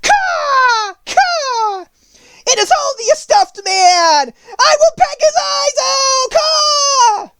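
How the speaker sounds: very high, shrieking crow voice; cawing King Crow. Acted. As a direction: screech like a crow. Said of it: "the King Crow said".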